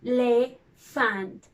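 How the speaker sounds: "Elephant" slowly as e, le, phant; split into syllables. Shown as a demonstration. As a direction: drop the chin once per beat of "Elephant". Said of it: "that's the number of syllables. Let's try".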